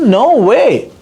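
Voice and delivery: sharp high cry of disbelief; shocked disbelief. Retold or imitated. Hearing his church was bombed, stunned disbelief.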